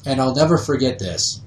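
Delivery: ordinary talk, nothing marked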